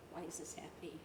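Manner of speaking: a low, hollow lament; despairing. Acted. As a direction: ask it to nobody, defeated. Despairing question.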